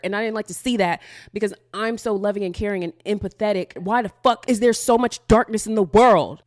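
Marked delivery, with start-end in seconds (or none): 3.8-6.4 angry outburst. Real, not acted. Venting rage at the world.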